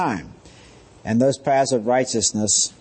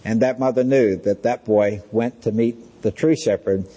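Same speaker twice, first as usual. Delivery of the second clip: ordinary talk, nothing marked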